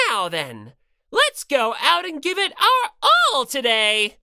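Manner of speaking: cheery mascot announcer voice; Monokuma voice. Acted. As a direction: play a gleeful bear mascot announcing.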